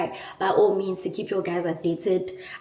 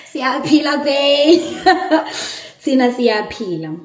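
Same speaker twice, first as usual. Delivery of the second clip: giggling, higher and louder, through laughter; laughing reply; 6 semitones higher, 7 dB louder than usual. Real, not acted. Bride-to-be laughing back at the letter's greeting. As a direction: answer the letter while laughing with joy.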